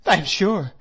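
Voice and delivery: a tearful outburst; tearful plea. A narrator's reading. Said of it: "with many tears".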